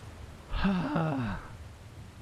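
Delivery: long, breathy sigh; heavy sigh. Real, not acted. Player catching breath after an attack, heavy sigh.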